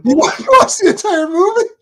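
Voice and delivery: high-pitched, loud disbelieving question; mock astonishment, voice shooting way up; retold incredulity. Acted disbelief at grandfather.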